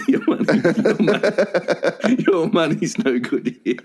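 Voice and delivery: cracking up, barely getting the words out; real laughter breaking up the words; laughing. Real, not acted. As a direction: repeat it through giggles. Cracks up repeating the other's line.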